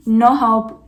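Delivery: usual speaking voice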